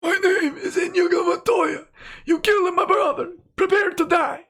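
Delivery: very high, crying put-on voice; Lemoyne's voice. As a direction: wail the vengeance line in a high, tearful voice. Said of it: "Now read Montoya's line in Lemoyne's voice".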